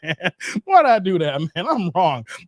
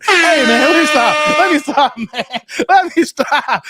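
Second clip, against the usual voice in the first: loud laughing protest; 6 semitones higher, 7 dB louder than usual. Real, not acted. Laughing at his own joke.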